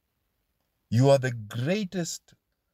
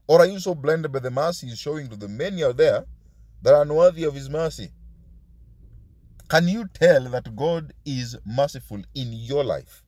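normal talking voice

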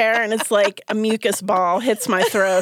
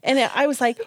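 ordinary talk

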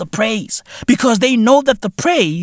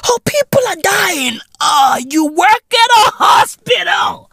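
mock whiny doctor; 10 semitones higher than usual. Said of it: "complaining doctors mocked".

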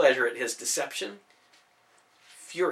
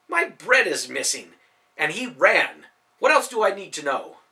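louder, rising indignant protest; indignant outburst; 4 semitones higher, 7 dB louder than usual. Acted. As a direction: defend yourself hotly. Baker angrily justifying himself.

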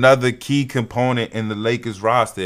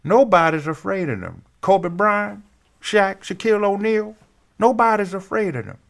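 lightly voiced Barkley, a bit higher; Charles Barkley voice; 7 semitones higher than usual. Host reading Barkley's quote to viewers, lightly imitating Barkley. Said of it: "let me do it in his voice".

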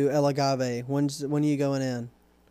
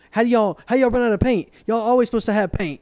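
higher, louder put-on voice of the pushy customer; mock indignant customer; 6 semitones higher, 4 dB louder than usual. Customer demanding paint, mock indignant put-on voice. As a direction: ham up the outraged caller, pitched up. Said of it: "And he said".

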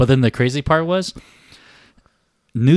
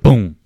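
loud vocal boom of jamming cogs; punchy mouth-made crash; imitated machinery noise; 10 dB louder than usual. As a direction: imitate elevator cogs ending in a bang. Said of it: "you can hear the cogs".

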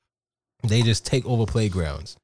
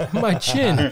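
lightly voiced, higher-pitched kid; mock child; 10 semitones higher than usual. Kid explaining a caught punch to his dad, mock childish. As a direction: play a kid proudly reporting to Dad.

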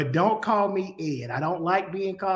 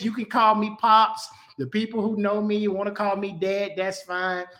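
ordinary delivery

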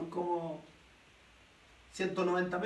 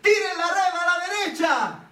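shouting; 7 semitones higher, 5 dB louder than usual. Acted. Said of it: "les grita".

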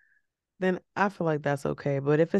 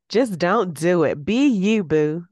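louder, slow, put-on sassy punchline; mock sassy advice; 7 dB louder, slower than usual. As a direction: hams up a sassy motto, then disowns it. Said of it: "that didn't even sound right".

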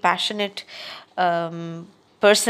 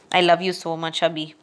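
normal talking voice

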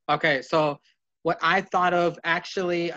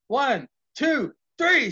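referee count; 9 semitones higher, 4 dB louder, slower than usual. Retold or imitated. Calling Michaels' pinfall on Hogan, count rising to a peak. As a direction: count building higher to an excited peak.

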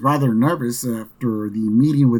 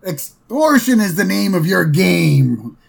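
put-on higher, louder indignant old boss, peak on oh; old boss Burns; 6 semitones higher, 4 dB louder, slower than usual. Boss refusing to pay more, high indignant scoff. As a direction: scoff in high, loud outrage. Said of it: "Burns goes".

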